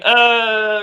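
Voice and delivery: high, loud, drawn-out "uh"; exaggerated reaction noise. Shown as a demonstration. Debater showing what the actress is not doing, overblown reaction. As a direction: overdo a long, obvious "uhhh". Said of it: "Not going".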